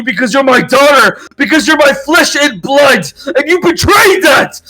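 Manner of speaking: father disowning his daughter in a shouting fury; furious shout. Acted. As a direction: rage at a daughter who betrayed you.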